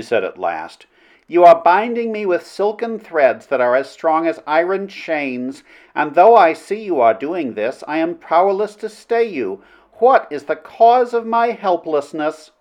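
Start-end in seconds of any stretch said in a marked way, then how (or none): 1.3-12.5 higher-pitched princess voice; spellbound princess. Acted. Princess confesses to Flynn his mesmeric influence, spellbound. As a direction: speak as a woman falling under a spell.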